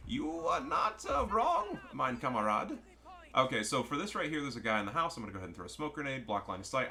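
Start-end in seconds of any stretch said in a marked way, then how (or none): 0.1-2.8 put-on high, theatrical German accent. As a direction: play a hammed-up German explaining things. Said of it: "I'm doing the German accent".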